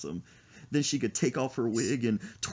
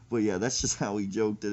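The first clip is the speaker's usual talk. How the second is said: usual speaking voice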